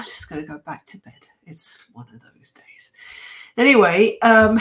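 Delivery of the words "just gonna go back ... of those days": whispering. Real, not acted. Tired aside about going to bed.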